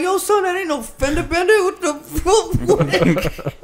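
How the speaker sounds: high, squealing disbelief breaking into laughter; retold disbelief. Dad reacting to his son's wrecked car, high laughing disbelief.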